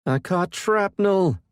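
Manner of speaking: high, loud, slow pained yell. A narrator's reading. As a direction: yell out hit by shrapnel.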